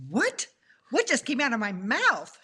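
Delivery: high, loud stunned disbelief; shocked. Retold or imitated. Woman shocked by her own prayer, high stunned outburst. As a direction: react as if the words shocked you.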